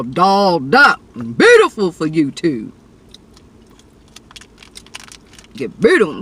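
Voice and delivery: playful mock of YouTubers who get dolled up. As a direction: poke fun at the glam crowd.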